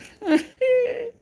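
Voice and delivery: a shrieking, crying-with-laughter burst; squealing laugh near tears; high squealing laugh. Real, not acted. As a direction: lose it completely at the joke. Cracking up at chat.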